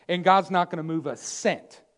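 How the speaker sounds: usual speaking voice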